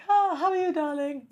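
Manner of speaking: high, sweet mock housewife greeting; old-school housewife. Housewife greeting husband home from work, mock-sweet. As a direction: coo it like an advert housewife. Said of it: "she's like".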